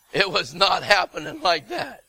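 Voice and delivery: normal talking voice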